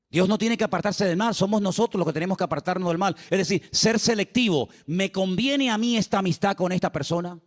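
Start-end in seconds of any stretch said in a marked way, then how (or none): none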